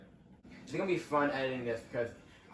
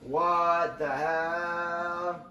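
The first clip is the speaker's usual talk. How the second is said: slow, drawn-out announcer impression, louder; copying the announcer; 5 semitones higher, 5 dB louder, much slower than usual. Kid copying the game announcer, drawn out and louder. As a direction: stretch it out like the race announcer. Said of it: "I would copy what the announcer said".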